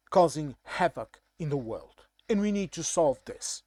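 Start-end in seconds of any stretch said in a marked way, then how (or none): none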